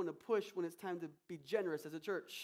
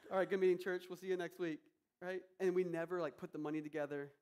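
ordinary talk

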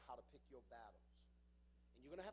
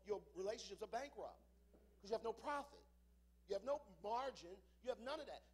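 loud, rising preacher's cadence; preaching at full force; 7 semitones higher, 7 dB louder than usual. Acted. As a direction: drive it home to the congregation.

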